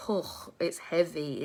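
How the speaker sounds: ordinary delivery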